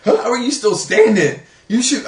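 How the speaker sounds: high, loud astonished question; mock amazement that the punch failed; disbelief. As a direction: sound stunned he's still up. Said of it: "I'm sitting there just like".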